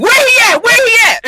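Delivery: high, loud mock calling out; repeated high-pitched LA-accented yell; imitated background hollering. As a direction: holler it high like a searching crowd. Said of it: "all of them in the background".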